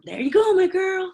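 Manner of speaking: a loving uncle's proud praise. Retold or imitated. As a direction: cheer her on the way her uncle used to. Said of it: "I always hear him in my head".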